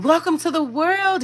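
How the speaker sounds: high, tender greeting to a newborn; tender welcome. Retold or imitated. Godmother welcoming the newborn to the world, tender and high.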